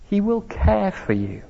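soft, gentle reassurance; tender. Shown as a demonstration. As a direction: say it tenderly, leaning on will. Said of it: "Can you sense the tenderness".

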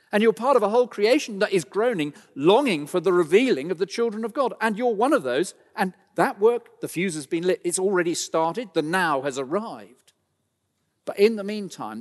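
high, loud, ringing preaching; full-force preaching. Acted. Preacher rallying his suffering congregation, loud and high. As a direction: drive the assurance home to the congregation.

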